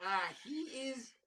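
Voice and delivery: incredulous inner voice. Retold or imitated. Comic replays his own thoughts at the scene, high and exasperated. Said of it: "the whole time I was like".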